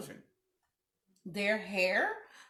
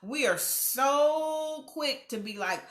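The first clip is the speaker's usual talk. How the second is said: normal talking voice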